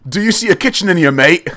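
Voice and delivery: put-on barman's British accent. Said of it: "he's like".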